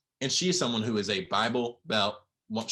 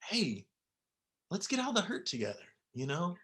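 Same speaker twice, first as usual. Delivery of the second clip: tender comfort; 6 semitones higher than usual. Retold or imitated. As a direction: gently coax someone out of their hurt. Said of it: "if the world just went".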